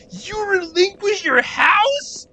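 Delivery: mock high-pitched disbelief; incredulous shock. Longtime players hearing about the lost house, incredulous. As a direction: stretch it out very high, aghast.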